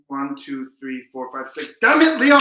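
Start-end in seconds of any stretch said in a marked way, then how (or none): 1.8-2.4 amazed exclamation, higher and louder; stunned cry after the magic trick; amazed outcry. Real, not acted. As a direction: burst out in disbelief at the trick.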